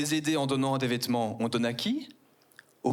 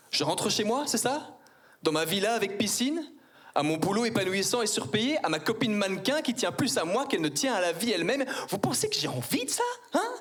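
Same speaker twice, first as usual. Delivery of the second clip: high, outraged rhetorical questions; hammed-up self-pity; mock-offended rant; mock indignation; 8 semitones higher than usual.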